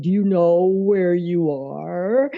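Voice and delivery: slow, lightly spooky vampire-movie voice; a hint of an old horror film villain; horrible Bela Lugosi voice. Demon in hell asks a trapped man, lightly spooky horror voice. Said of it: "this horrible voice, kinda like from a Bela Lugosi movie".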